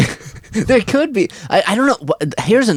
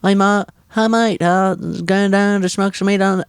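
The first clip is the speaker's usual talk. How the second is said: slightly raised Australian accent; high-pitched Australian attempt; 4 semitones higher than usual. Shown as a demonstration. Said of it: "can't even do a high-pitched voice".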